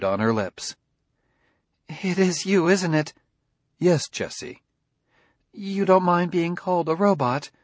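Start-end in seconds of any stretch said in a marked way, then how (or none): 1.9-7.5 tearful. A narrator's reading. Jessie tearful, hopeful.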